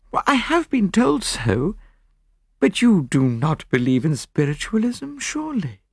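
high-voiced widow, questioning; doubtful widow. Plays the widow in conversation.

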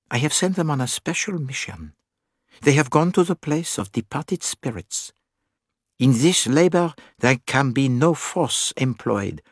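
put-on deliberate, accented explanation in measured phrases; Poirot, gravely explaining.

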